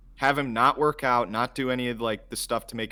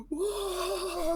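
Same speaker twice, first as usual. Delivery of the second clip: put-on gawking kid; 4 dB quieter than usual.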